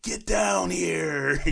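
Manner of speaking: high, loud and slow, drawn out; Scorpion voice. Retold or imitated. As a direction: drag it out like Scorpion. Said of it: "Scorpion's voice when he's like".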